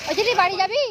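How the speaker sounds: loud teasing call; yelling across the room. Real, not acted. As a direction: shout the question across. Calling out to child.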